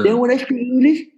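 high imitation Indian English; mock Indian-accented English. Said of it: "toda la lengua está arriba".

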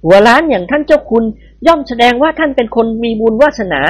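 wife scolding her husband, high and piping; shrill scolding wife. Acted. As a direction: chide your husband in a piping voice. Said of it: "ดุเขาด้วยเสียงแจ๋วๆ".

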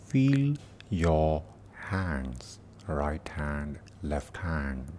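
slow meditation voice with long pauses; guided meditation. Acted. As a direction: guide them gently through the body, unhurried.

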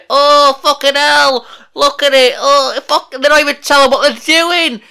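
high, loud, hammed-up fan outrage; mock outraged fans. Imitating fans going nuts online, mock outraged and loud. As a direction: play the fans losing their minds. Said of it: "people would fucking go nuts and say".

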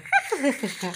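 happy tickled giggle; giggling. A narrator's reading. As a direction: laugh as if being tickled. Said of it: "ruiditos de felicidad".

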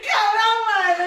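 loud mock outrage. Friend reacts to the orphanage plan with a hammed-up shout. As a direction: blurt it out in fake shock.

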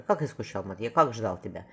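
ordinary delivery

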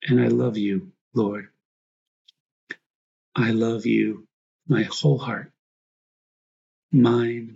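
usual speaking voice

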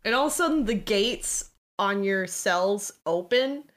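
usual speaking voice

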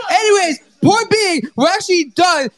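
high, pumped show sign-off; announcer outro. Acted. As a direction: hype up the shout-outs to close. Sign-off shout-outs, raised pitch.

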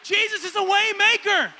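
high, loud preaching voice. Acted. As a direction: preach it to the church. Preaches to the church.

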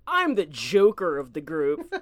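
mock self-styled joker, low and slow; dickhead quote. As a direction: drag it out low, mocking the braggart. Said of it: "quote any dickhead who's like".

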